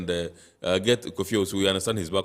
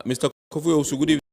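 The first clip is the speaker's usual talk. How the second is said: to someone hard of hearing; 8 semitones higher, 7 dB louder, much faster than usual. Real, not acted. Host calling to faint caller.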